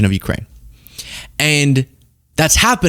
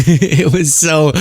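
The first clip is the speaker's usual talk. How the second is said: laughing through words; much slower than usual. Real, not acted. Laughing after the shout.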